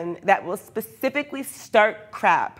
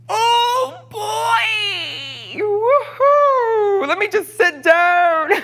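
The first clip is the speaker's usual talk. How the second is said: high giddy whoop, laughing; giddy outburst; 11 semitones higher, 3 dB louder, much slower than usual. Real, not acted. Laughing at her own troll story, a giddy high whoop. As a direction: whoop it out, cracking up at yourself.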